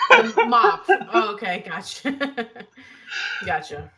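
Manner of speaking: laughing through the words; giggling while catching on. Real, not acted. Laughing at the mop joke.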